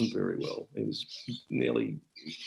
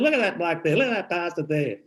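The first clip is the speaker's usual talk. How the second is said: put-on higher, louder delighted old bloke; Ron Palmer; 9 semitones higher, 3 dB louder than usual. Old Ron pointing out a bloke to the host, high and delighted. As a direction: point and chuckle like an old digger. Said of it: "He said".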